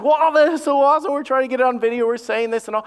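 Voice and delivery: high, gushing mock amazement; retold parental excitement. Father retelling baby's first word, mock-excited gush.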